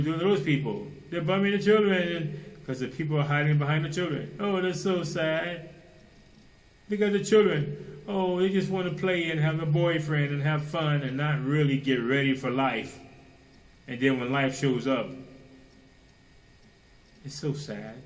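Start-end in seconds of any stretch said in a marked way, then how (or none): 4.4-13.0 high, slow, gushing fake sympathy; mock pity. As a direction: ham up shallow pity for the children. Mocking sympathy quote.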